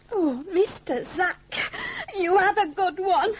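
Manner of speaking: crying out thanks through a laugh; overcome, half sobbing half laughing; tearful gratitude. Acted. Martha overwhelmed thanking Zack for the shilling.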